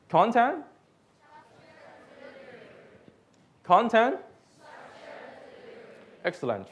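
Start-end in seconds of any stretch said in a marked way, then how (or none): 0.1-4.2 prompting call. Shown as a demonstration. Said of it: "repeat after me".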